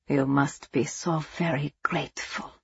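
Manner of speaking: soft, sneering and quiet; softly. Acted. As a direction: purr it softly with mock sympathy. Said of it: "von Essen said softly".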